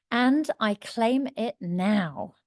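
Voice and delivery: slow, deliberate affirmation, word by word; emphatic affirmation. Shown as a demonstration. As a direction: draw out each word with conviction. Repeats affirmation slowly for emphasis.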